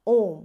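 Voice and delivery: ओम with a long, held-out ओ; prolonged vowel. Shown as a demonstration. Teacher showing a triple-length vowel, ओम drawn out. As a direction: stretch the ओ in ओम well past normal length. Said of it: "जिसका आशय होता है तिगुना समय".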